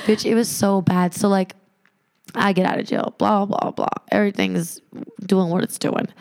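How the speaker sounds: normal talking voice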